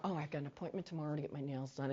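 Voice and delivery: quick, soft aside to yourself; hushed, hurried mental to-do note; distracted inner thought. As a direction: let your mind wander under your breath. Acting out a helper's distracted thoughts.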